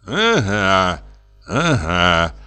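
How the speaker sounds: drawn-out, knowing little murmur; gloating mutter. A narrator's reading. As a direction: mutter it to yourself, savouring the catch. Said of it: "пробормотал Пётр Демьяныч и так злорадно".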